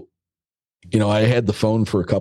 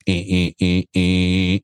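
buzzy sing-song beeps mimicking a dead phone line; imitated disconnect beeps; slower than usual. Recalling the landline after the caller hung up, imitated beeps. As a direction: copy the old landline hang-up tone. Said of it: "it would go".

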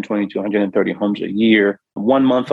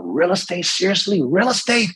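high-pitched retold disbelief, climbing higher each time; incredulous outcry; 9 semitones higher than usual. A man shouting at the ceiling, answering God: incredulous, pitched high. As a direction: blurt it like you can't believe the answer. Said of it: "and I yelled out".

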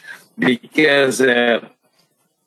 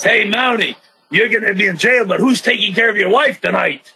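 higher taunting mock voice; imitating Big Boss Man; 6 semitones higher than usual. Wrestler retells Big Boss Man taunting him, lightly voiced jeer. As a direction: needle the jailed Mountie with a sly jab. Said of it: "So he says".

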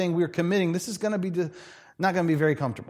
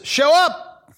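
emphatic call; 10 semitones higher, 6 dB louder than usual. Acted. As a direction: show up, loud and high, a preacher's punch. Preacher driving home point.

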